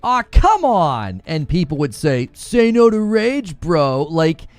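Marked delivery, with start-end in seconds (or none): 0.0-1.2 stretched, higher-pitched groan of protest; mildly frustrated. Retold or imitated. Streamer retells what he used to say, mildly frustrated. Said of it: "like, a little frustrated". 2.3-4.0 high, drawn-out scolding; mocking viewers. Streamer imitates viewers nagging him, mocking.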